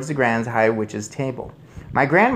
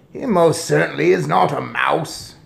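higher, louder, emphatic denial; indignant shout; 4 semitones higher, 5 dB louder than usual. A narrator's reading. Father contradicts grandmother, shouting. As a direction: contradict her forcefully. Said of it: "shouted Mister Jenkins".

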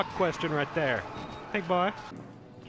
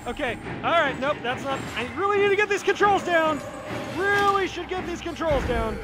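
high, rattled voice; flustered panic; 12 semitones higher than usual. Real, not acted. Player who hasn't got the controls down, flustered. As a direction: fret in a high voice.

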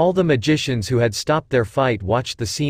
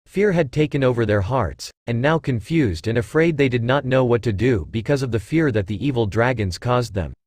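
usual speaking voice

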